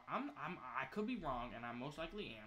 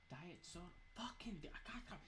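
whisper; 4 semitones higher, 8 dB quieter than usual. Real, not acted. Whispering while looting.

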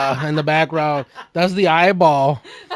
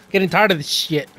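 ordinary delivery

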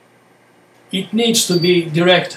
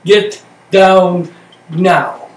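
slow calm repeat; 3 dB louder, much slower than usual. Shown as a demonstration. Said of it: "slow quiet echo after the shouting".

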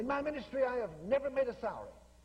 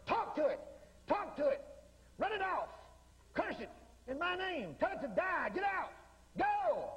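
higher-pitched, punchy orders in bursts; commanding; 5 semitones higher than usual. Acted. Preacher ordering the mountain to go, commanding. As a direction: cast it out with emphatic commands.

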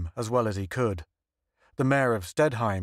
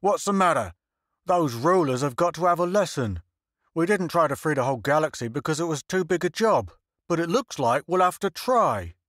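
worked-up bluster; 5 semitones higher than usual. A narrator's reading. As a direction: rant at them as the offended mayor. Mayor blustering about punishing rulers.

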